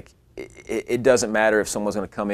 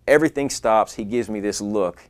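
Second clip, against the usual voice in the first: usual speaking voice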